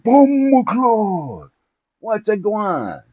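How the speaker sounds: put-on slow, high Jamaican accent greeting. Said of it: "this Jamaican".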